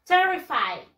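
terrified said "TER-ri-fide", high first syllable; correct pronunciation. Shown as a demonstration. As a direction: model "terrified" clearly, ending in "fide". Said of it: "De esta manera".

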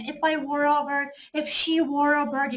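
slow, soft example with were; slow subjunctive example. Shown as a demonstration. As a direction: read were sentences slowly. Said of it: "무조건 were를 써야 돼". (were 예문을 천천히)

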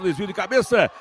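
huge held goal shout; explosive goal commentary. Real, not acted. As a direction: scream the goal, long and high. Second goal scored. (grito de gol explosivo)

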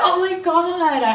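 high, loud delighted gasp; amazed. Real, not acted. Vlogger amazed by QR ordering, high delighted gasp.

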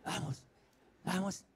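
fading to a whisper; whispering over a crib. Acted. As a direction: hush yourself, voice dying away. Urging self quietly.

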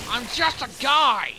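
high, loud pleading voice; scared character voice. Pretend. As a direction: plead like a panicked bystander.

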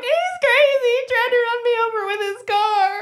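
high, loud mock distress; mock crying. Calling girlfriend, hammed-up upset wail. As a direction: whine it like a fake sob story. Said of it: "I called my girlfriend crying".

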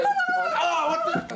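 very high startled squeal; shocked shriek. Acted. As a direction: squeal in sudden fright. Startled by something.

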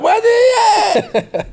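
high, drawn-out laughing cheer; excited shout. Pretend. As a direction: whoop it like a sudden joyful awakening.

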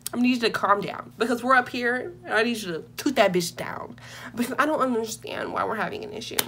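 usual speaking voice

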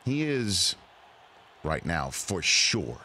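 "right now" drawn out slowly and low; slow stress on RIGHT NOW. Shown as a demonstration. Host mocking the GM's wording, slow weighted RIGHT NOW. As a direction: linger on "right now", then drop "for sure". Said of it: "Where'd you put the accent?".

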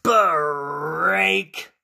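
loud, stretched-out "break"; dramatic, elongated crash word. Acted. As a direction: draw out the break like a sound effect. Toy breaks in the story.

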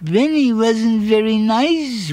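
ordinary delivery, nothing marked

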